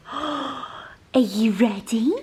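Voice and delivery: gasp, then a breathy secret whisper; teasing whisper. Real, not acted. To her dog before walkies, teasing whisper. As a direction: hush it like a surprise for the dog.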